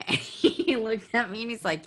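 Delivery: ordinary delivery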